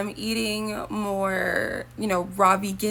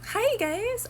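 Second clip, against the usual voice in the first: much higher, sing-song fake-cheery hello; mock vlogger greeting. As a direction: parody an empty bubbly greeting. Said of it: "come on here just to go".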